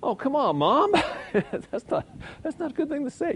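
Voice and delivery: high whiny protest to Mom; high mock complaint at Mom. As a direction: whine it like a child objecting.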